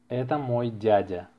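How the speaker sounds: ordinary talk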